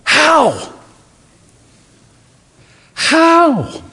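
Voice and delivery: high, incredulous "How?" repeated; incredulous asking. Retold or imitated. Preacher voicing the listener's baffled high question. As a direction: ask it high in disbelief. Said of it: "you've got to ask yourself".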